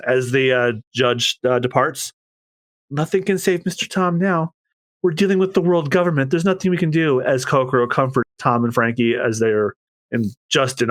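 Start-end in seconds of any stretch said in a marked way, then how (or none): 2.9-7.2 high, fast hopeless voice; despairing onlooker. Acted. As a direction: admit defeat.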